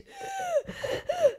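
high wheezing gasp for air; labored gasp. Retold or imitated. Preacher imitating the COPD woman gasping for air. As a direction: imitate a woman fighting for breath.

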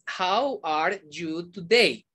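"How are you today?" slowly, each word separate. Shown as a demonstration. Teacher showing learners what not to say, word by word. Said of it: "Yo no digo".

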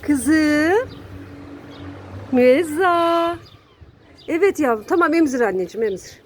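sevgiyle, yüksek sesle kediye seslen; kediye tatlı ses. Real, not acted. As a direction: anne şefkatiyle kedi sevme. (kediye seslenen sahibi, şefkatli ve tiz)

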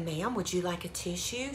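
retold hushed offer, softly; quietly, like a discreet shop clerk; imitating a discreet hushed clerk. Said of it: "She kinda whispered it".

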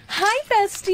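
high, loud, overeager hello; gushing greeting. Acted. Friend greets the girl who avoids her, gushing. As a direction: squeal it sweetly across the room.

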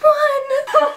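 high count through laughter; laughing count. Retold or imitated. Vlogger voicing Anastasia counting whip hits, laughing. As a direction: squeal the count while laughing. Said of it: "and she's like".

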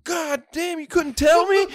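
high, loud imitation of an irate man; mock yelling. Drunk customer blaming the cashier, yelling. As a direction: yell it like an outraged customer. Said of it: "are you fucking yelling".

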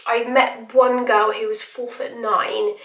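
usual speaking voice

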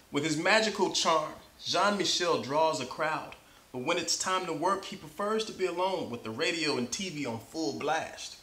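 plain reading voice

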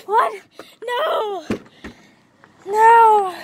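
high screamed no; panicked scream. Real, not acted. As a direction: cry out in panic. Caught again, protesting.

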